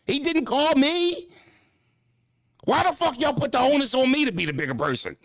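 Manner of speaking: high-pitched exasperated protest; indignant protest. Real, not acted. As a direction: push back, voice up high in disbelief. Indignant defense against fans.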